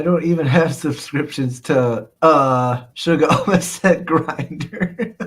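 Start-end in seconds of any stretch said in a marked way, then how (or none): 2.9-5.3 slow, words breaking up with laughter; laughing while reading. Real, not acted. Streamer reading chat's joke about his slip, laughing. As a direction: crack up partway through.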